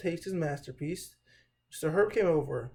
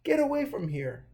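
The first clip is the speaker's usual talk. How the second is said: higher, sharp command to leave; angry order; 7 semitones higher, 3 dB louder than usual. Acted. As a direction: order him out in anger. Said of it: "Mister Herb was very angry".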